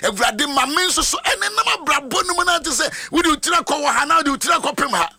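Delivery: high-pitched, fervent preacher's declaration; prophetic preaching. Real, not acted. Preacher telling the congregation these are prophetic declarations, fervent preaching.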